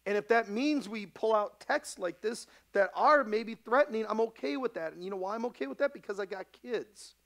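loud, high, driving pulpit delivery; rising fiery sermon voice; preaching at full force. Acted. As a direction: preach it hard to the flock.

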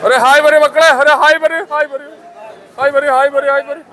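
high, loud call to the workers. Real, not acted. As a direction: call out cheerfully across the harbour. Vlogger calling to workers for hi.

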